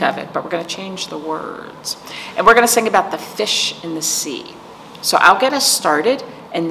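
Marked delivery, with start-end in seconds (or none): none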